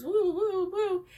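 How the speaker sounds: high, loud swooping woos; imitated rolling woo. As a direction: whoop as if tumbling down a hill. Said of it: "pushes you down this thousand-foot hill that goes".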